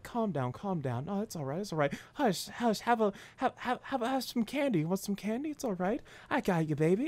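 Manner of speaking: soothing mob boss, lilting upward; Dino, very calm. Retold or imitated. Mafia boss hushes his angry former protégé, very calm. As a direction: coax and hush, unbothered. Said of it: "just, like, a very calm person".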